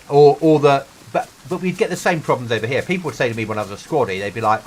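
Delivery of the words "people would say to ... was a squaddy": ordinary talk, nothing marked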